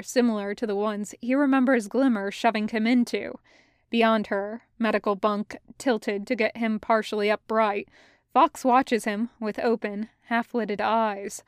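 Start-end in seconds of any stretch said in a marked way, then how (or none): none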